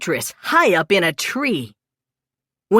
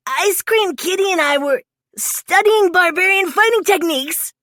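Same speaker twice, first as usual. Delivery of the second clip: Mikey voice; 10 semitones higher than usual. A narrator's reading. Mikey's excuse voice.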